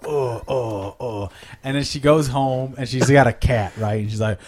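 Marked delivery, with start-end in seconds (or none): 0.0-1.3 mock labored groans. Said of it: "she's on her crutches and she's all".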